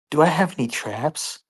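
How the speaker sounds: ordinary talk, nothing marked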